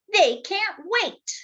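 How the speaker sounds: put-on high, bright child's voice. H tells the others the fish are excited, child voice. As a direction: sound like an eager little kid. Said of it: "H said".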